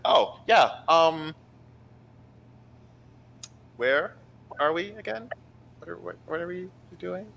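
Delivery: high, confused, drifting; ditzy and lost; scatterbrained high voice. Acted. GM voices distracted Uso.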